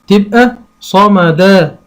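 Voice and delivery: صمدا stretched out very slowly; very slow sounding out. Shown as a demonstration. Teacher sounding a word out for a child, slowly. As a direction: draw صمدا out syllable by syllable. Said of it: "هقول صمدا".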